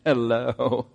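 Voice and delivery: lightly voiced sly greeting; a hint of teasing Jesus; playful twinkle. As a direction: greet her with a hidden smile. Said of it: "there's gotta be a twinkle in the eye".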